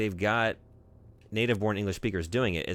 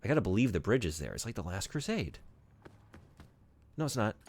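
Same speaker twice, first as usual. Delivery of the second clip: ordinary delivery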